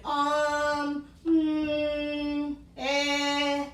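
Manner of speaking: filler sounds. Shown as a demonstration. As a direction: demonstrate the fillers to avoid. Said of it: "Filler words are like".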